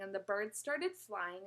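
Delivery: ordinary delivery, nothing marked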